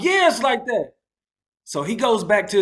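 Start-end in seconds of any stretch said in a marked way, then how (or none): 0.0-0.9 high, loud, cocky comeback; defiant. Retold or imitated. Snapping back at the angry driver, cocky and defiant. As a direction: throw it back at him, daring. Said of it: "And I'm like".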